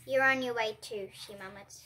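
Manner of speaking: ordinary delivery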